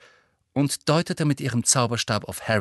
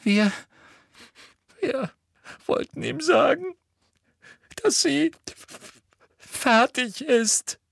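very high, halting, slow choked words. A narrator's reading. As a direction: force the words out between sobs. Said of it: "würgte Hermine hervor". (hervorgewürgt)

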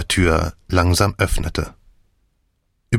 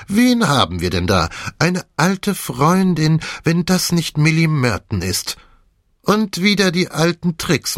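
mocking detective; 9 semitones higher than usual. A narrator's reading. Jeb unmasking the swindler, mildly mocking. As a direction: savour unmasking her.

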